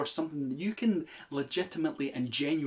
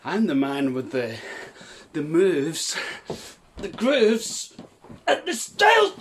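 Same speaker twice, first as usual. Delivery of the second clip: building hype intro; 3 semitones higher, 6 dB louder, slower than usual. Acted. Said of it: "I'm the man who doesn't need any fancy introductions".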